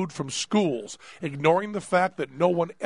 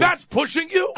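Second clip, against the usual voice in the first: high, loud outraged shout; indignant disbelief; 13 semitones higher, 4 dB louder than usual. Acted. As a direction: act shocked and offended. Mock outrage at cohost.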